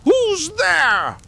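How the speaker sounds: high, loud startled cry. A narrator's reading. Old man sprung up in bed at a noise, crying out high. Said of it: "crying out".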